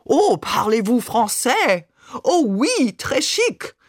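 high, hammed-up chic Frenchwoman; affected, swooping French parody; mock French airs. A narrator's reading. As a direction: tease with exaggerated pursed-lip French. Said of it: "mit erhobenem Kinn und gespitzten Lippen".

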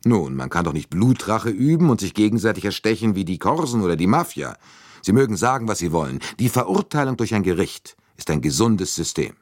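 lightly voiced indignant English colonel; stern colonel. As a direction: colonel defending private justice, firm.

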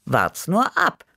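drawn-out, knowing tease, rising high; slow, cocky promise; knowing better. A narrator's reading. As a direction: stretch it out like you know better. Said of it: "wusste ich es besser".

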